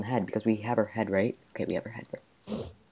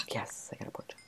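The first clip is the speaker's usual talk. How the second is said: quiet unvoiced whisper; 12 dB quieter than usual. Real, not acted. Player whispering glee over a pork chop. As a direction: hush it to yourself. Whispered aside while playing.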